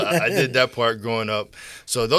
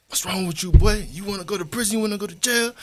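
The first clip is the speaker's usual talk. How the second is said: higher, imitating a furious father; dad scolding his boy, raised pitch; retold angry dad; 10 semitones higher, 4 dB louder than usual. Father jacking son against closet door, angry scolding. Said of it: "he was like".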